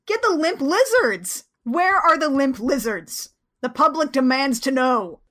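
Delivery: hammed-up public outcry; mock demand. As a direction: demand it like an indignant fan. Mock outrage demanding joke band.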